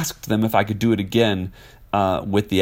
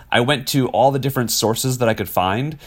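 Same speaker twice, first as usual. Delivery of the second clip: ordinary delivery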